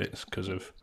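ordinary delivery

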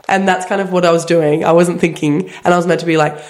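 normal talking voice

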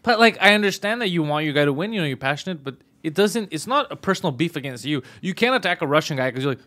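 ordinary delivery

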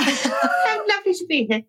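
high, slow royal impression; hammed-up regal English voice, drawn out; the Queen. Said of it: "like the queen".

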